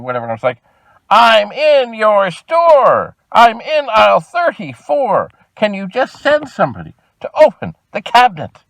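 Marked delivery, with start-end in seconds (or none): 1.1-8.6 much higher and louder, slow and emphatic; exasperated shouting. Retold or imitated. To the clerk who insists it's out of stock, an exasperated slow shout. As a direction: hammer home that you are right there. Repeating demand to phone clerk.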